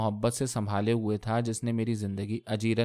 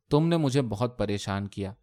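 plain reading voice

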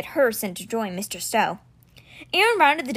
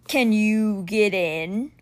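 growling beast; much slower than usual. Acted. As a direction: growl it out slowly like a huge winged cat. Simber the stone beast speaks.

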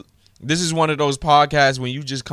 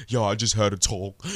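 mocking his voice; 4 semitones lower, 3 dB quieter than usual. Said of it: "was like".

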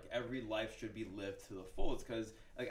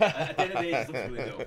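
laughing; 3 semitones higher, 13 dB louder, faster than usual. Real, not acted. Laughing repeat of his punchline.

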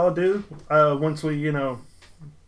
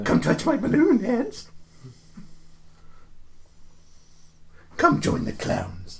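creepy clown; 6 semitones higher, 4 dB quieter, slower than usual. Pretend. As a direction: tempt like Pennywise the clown. Pennywise impression from It.

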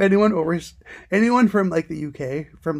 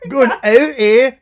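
slow, laughing mock Canadian; hammed-up "eh"; sign-off joke, laughing Canadian accent; 5 semitones higher, 10 dB louder, slower than usual. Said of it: "thought it was, like, Canadian".

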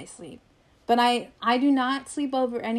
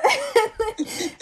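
squealing high laugh; 12 semitones higher, 4 dB louder than usual. Real, not acted. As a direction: let out a loud high laugh. Laughing at own story.

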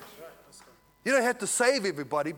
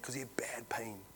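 whispered aside; 7 semitones lower, 13 dB quieter than usual. Real, not acted. Aside to congregation mid-story.

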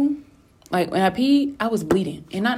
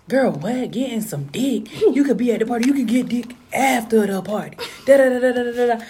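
talking like him; 6 semitones higher than usual. Retold or imitated. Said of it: "I'm really trying not to talk like him".

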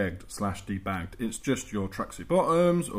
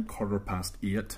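slow, softer Northern Irish accent; 2 semitones lower, 7 dB quieter, slower than usual. Shown as a demonstration. Answering co-host's time question, slow Northern Irish accent. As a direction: show off your one Northern Irish phrase, slowly. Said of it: "Northern Irish accent".

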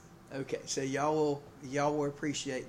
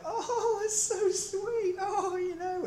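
much higher, slow, laughing mock-sweet wife voice; gushing wife; much slower than usual. Pastor plays the delighted wife in his joke, high and giggly. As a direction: coo like a flattered new bride. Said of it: "she was like".

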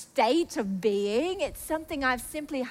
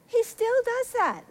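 high, slow exclamation; emphatic wonder; 9 semitones higher, slower than usual. Real, not acted. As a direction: repeat it high and drawn out. Same words repeated with emphasis.

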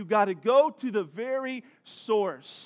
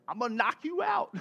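put-on higher-pitched kid's threat; mock threat; 6 semitones higher than usual. One of his girls threatening her sister, mock menace. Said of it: "a threat".

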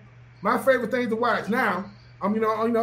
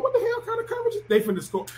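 high, fast, incredulous mock outburst; baffled player; 10 semitones higher, faster than usual. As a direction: imitate a player stunned by the coverage. Player baffled by defense on field.

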